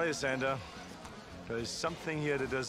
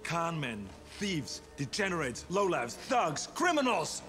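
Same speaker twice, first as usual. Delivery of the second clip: rising to a yell; building angry tirade; 4 semitones higher than usual. Acted. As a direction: pile on insults, then explode.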